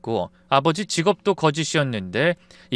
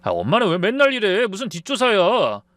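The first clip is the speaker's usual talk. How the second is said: higher-pitched, irritated protest; angry outburst; 6 semitones higher than usual. A narrator's reading. Son lashing out at mother over snooping, angry outburst. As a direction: snap back at mother in anger. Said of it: "버럭 소리를 질렀습니다".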